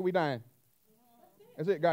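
ordinary talk

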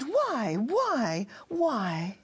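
mock-anguished repeated plea; pleading cry. A narrator's reading. Wife begs husband for a reason, crying out. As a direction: cry out each word, falling from high. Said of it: "she cried".

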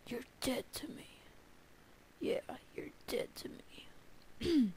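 breathy mock-threat whisper, drawn out. Gamer whispering a mock threat at the creeper. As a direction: hiss it slowly like a joking grudge.

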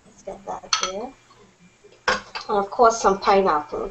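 ordinary talk

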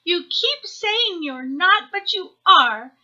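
high, loud little hare argument; childlike protest building toward tears; upset childish insistence. A narrator's reading. Hare argues before crying.

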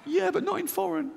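very high, whiny protest; panicky inner voice. Retold or imitated. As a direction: whine it up high like a scared child. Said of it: "This one goes".

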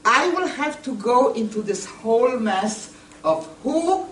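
ordinary talk, nothing marked

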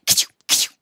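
loud, explosive mock sneeze; acted sneeze. Joe imagining Billy sneezing on pepper, explosive mock sneeze. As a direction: burst out with a fake ka-chow. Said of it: "Then again".

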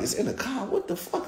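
half-laugh the question, then breathe the curse; laughing disbelief. Retold or imitated. Narrator's incredulous reply.

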